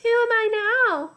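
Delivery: put-on girl's voice with a touch of giggle. A narrator's reading. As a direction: chirp it like a sweet young girl. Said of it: "It was a girl's voice, soft and warm".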